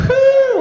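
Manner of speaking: loud high exhale after laughing; high whew after cracking up. Real, not acted. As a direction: blow out a big relieved whew.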